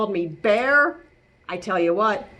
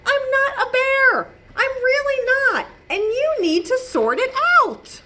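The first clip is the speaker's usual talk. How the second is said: high, raised protest; frustrated shout; 12 semitones higher than usual. Acted. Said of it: "I'm sorry if I shout".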